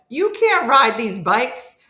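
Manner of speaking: loud, high and drawn out; emphatic, fed-up objection; exasperated protest. Retold or imitated. Acted protest at riding broken bikes.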